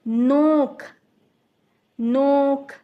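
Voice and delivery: नोक called out loud with a held ओ; loud demo word. Shown as a demonstration. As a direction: project नोक so they hear the ओ. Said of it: "ओ की आवाज़ आ रही है". (ज़ोर से नोक, ओ को पकड़कर)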